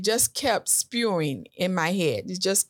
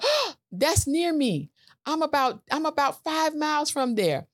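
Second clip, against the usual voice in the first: excited; 7 semitones higher than usual. Retold or imitated. Store found near her.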